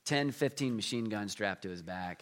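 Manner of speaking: ordinary talk, nothing marked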